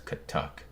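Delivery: soft whispered footsteps; hushed katuk katuk footstep noise; mouthed footstep sounds. Retold or imitated. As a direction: click out footsteps under the breath. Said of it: "more footsteps".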